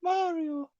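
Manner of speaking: very high cartoon Mario cry. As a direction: squeak out Mario like the game. Calls out after Princess Peach.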